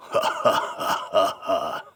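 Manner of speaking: deliberate evil laugh in bursts; villain's gloating laugh. Acted. Villain laughing over the fallen hero, gloating. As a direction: laugh it out slowly over the victim.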